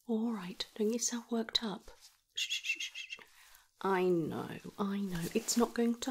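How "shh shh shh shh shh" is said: soothing shush. Acted. Calming upset patient.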